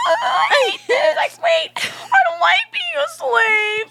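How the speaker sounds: hammed-up high wail of protest; mock whiny shout. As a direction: shriek it like a spoiled crybaby. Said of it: "And he went".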